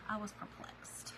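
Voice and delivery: breathy whispered confession; whispered perplexed; whispered aside. Real, not acted. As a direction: drop to a whisper to repeat it.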